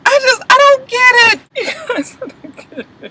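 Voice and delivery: high, slow, crying-out disbelief; exasperated whine. Real, not acted. Vlogger wailing in disbelief at the cast's cheap shoes, exasperated high whine. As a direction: wail it like you just can't believe it. Exasperated rant about show.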